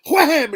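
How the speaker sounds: loud high shout of wham; impact yell. Retold or imitated. Acting out the headbutt with a loud wham. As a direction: punch out the hit noise.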